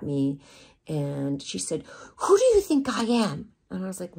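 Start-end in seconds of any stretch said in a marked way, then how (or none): 2.2-3.5 higher, louder indignant demand; angry confrontation. Retold or imitated. Agent pointing at the model, angry confrontation. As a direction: fire the question at her.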